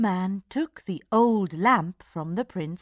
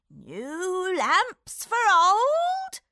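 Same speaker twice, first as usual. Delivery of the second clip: put-on high, slow wavering old man; old man calling out; 11 semitones higher, much slower than usual. A narrator's reading. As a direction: call out like a hawker. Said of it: "he shouted".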